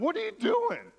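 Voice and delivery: way up high, mock disbelief; shocked squeak. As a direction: fling it out in a high astonished squeak. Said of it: "I'm like".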